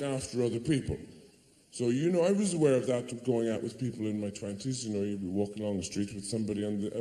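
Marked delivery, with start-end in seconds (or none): none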